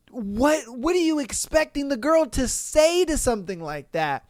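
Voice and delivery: usual speaking voice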